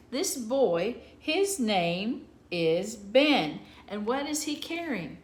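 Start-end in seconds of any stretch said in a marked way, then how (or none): 1.2-3.6 his name is Ben read very slowly and louder; slow reading aloud. Shown as a demonstration. Teacher to young readers, slow clear reading. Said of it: "reading the sentence aloud slowly".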